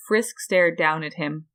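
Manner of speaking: usual speaking voice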